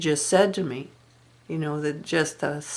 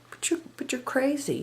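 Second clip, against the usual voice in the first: cool dismissive quote; 7 semitones higher, 8 dB quieter than usual. Retold or imitated. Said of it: "she'd say".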